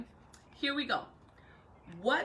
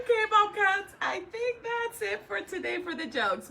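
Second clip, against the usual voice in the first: laughing; 10 semitones higher, 4 dB louder than usual. Real, not acted. Laughing through the wrap-up.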